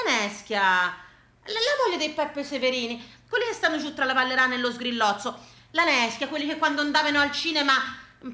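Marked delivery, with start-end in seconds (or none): none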